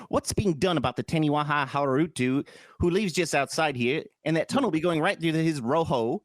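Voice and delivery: put-on Kiwi reading voice; New Zealand accent. Host quoting the article, put-on New Zealand accent. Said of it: "my New Zealand is just my Australian".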